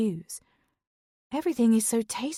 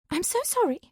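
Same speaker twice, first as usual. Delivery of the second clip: high squeaked apology, much higher; squeaky apology; 10 semitones higher than usual. Acted. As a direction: squeak it out, embarrassed. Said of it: "I squeak".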